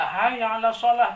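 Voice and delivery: mock muezzin call. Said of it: "Muazin teriak-teriak".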